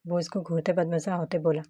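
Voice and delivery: ordinary delivery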